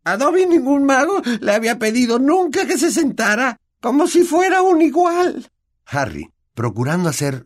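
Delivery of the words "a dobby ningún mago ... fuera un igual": very high, loud house-elf, amazed and moved; a servant never treated as an equal; very high, louder elf voice, tearful wonder. A narrator's reading.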